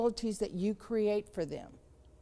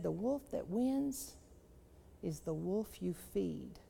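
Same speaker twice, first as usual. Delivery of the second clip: gentle wise grandpa; 3 semitones lower, 6 dB quieter, slower than usual. Acted. Grandpa delivers the moral.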